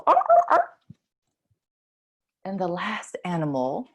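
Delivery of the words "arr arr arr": very high, loud seal arr barks. Retold or imitated. Teacher barking like a seal for the kids, high. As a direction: bark like a clapping seal. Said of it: "clap like a seal".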